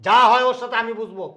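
loud, high, heated instructions; stern orders. Acted. Elder ordering the household to keep the gate shut, stern. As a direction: lay down the law loudly.

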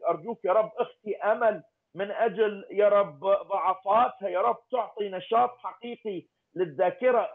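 normal talking voice

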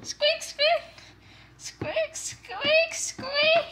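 very high squeaky bunny; one piercing little bunny squeak; chocolate bunny squeak. Pretend. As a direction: squeak like a toy rabbit. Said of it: "saying squeak".

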